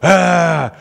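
loud, high mock roaring; toddler attack roar. Dad imitating Caden charging the tower, loud monster-toddler roar. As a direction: roar like a little destroyer. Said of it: "and he'll go".

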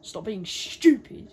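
loud, slow mock telling-off; scolding. Joking reply as the devil to pleading brothers, scolding. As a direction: scold them loudly, dragging the words.

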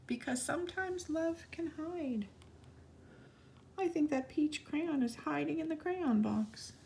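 whisper-squeak like something hiding; high, soft peekaboo voice. Acted. Hiding crayon, playful secret.

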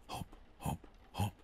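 breathy whispered hop hop hop. Acted. Narrator whispering the rabbit's hops in the story.

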